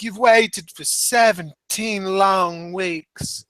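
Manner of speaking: milk each word like a wrestling promo in Australian; mock Australian accent. Host quoting Emma's promo in a slow, mock Australian accent. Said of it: "she actually called attention to that".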